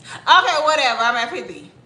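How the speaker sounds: offhand whatever delivery; mock indifference. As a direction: toss it off as no big deal. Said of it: "Or should it be this way?".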